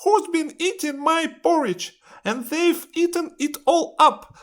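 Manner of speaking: put-on very high, loud baby bear squeal; tiny bear's high-pitched complaint. A narrator's reading. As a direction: squeak it out like an upset little bear. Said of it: "Baby Bear looks at his bowl".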